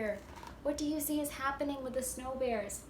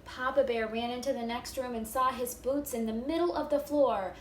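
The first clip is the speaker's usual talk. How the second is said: lively, louder read-aloud with bear voices; animated storybook reading; 6 dB louder than usual. A narrator's reading. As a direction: act it out for the first graders. Reading picture book aloud.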